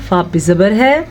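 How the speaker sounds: normal talking voice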